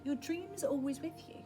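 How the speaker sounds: usual speaking voice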